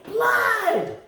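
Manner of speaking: high, loud shout of blood; yell. A narrator's reading. Loretta shouting at the police car. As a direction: holler it at the police.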